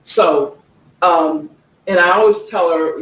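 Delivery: usual speaking voice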